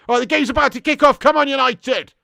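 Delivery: rally the viewers as the game starts; kickoff hype. Real, not acted. Streamer hyping chat at kickoff, excited.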